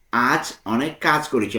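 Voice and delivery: ordinary delivery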